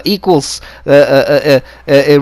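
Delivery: ordinary talk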